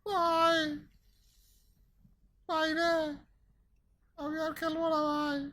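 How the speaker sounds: scared wail. Real, not acted. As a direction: wail that you won't play anymore. ভয় পেয়ে খেলা ছাড়তে চাওয়া. (ভয়ে উঁচু গলায় আর্তনাদ)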